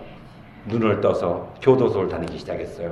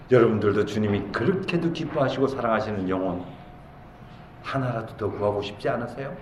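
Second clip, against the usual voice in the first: ordinary delivery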